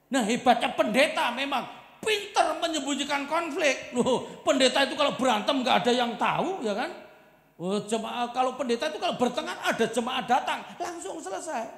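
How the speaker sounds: loud, high, laughing preacher's delivery; full-force preaching. Acted. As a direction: belt it to the congregation with a grin. Preacher joking at full volume.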